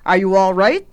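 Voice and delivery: put-on loud, hurried little-girl question; quick check, voice projected; hasty question. A narrator's reading. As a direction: ask hastily, rising at the end. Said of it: "the little girl asked hastily".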